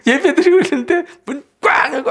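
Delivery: high, loud, laugh-filled telling; cracking up mid-story; laughing retelling. Real, not acted. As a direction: tell it through real laughter.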